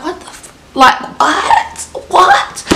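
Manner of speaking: hushed, breathless start rising to high-pitched shock; acted disbelief. Reviewer acting out a reader's shock, incredulous. Acts out stunned reader's reaction.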